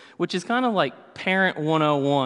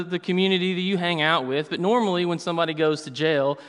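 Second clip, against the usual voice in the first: normal talking voice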